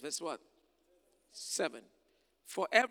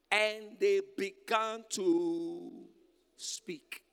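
slow dramatic reading; 3 semitones higher, 7 dB louder, much slower than usual. Real, not acted. Dramatic slowed scripture reading.

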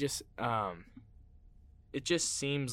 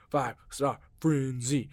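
announcer voice; 3 dB louder, slower than usual. Acted. Segment title repeated.

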